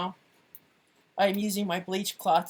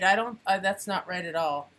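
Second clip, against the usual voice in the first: ordinary delivery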